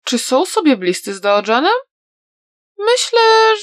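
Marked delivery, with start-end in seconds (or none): 2.8-3.6 put-on a young girl admitting something shyly; a hint of shy girl; lightly voiced young girl. A narrator's reading. Girl Atsing confides about Daojan.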